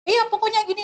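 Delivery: high, snappish brush-off; irritated dismissal. Retold or imitated. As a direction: wave him off impatiently. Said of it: "dia bilang".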